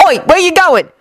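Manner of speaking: high-pitched "oi" after a friend; calling out. A narrator's reading. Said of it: "Ron called out".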